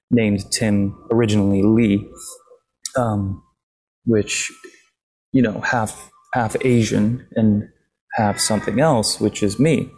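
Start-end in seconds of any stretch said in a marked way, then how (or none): none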